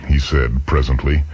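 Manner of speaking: usual speaking voice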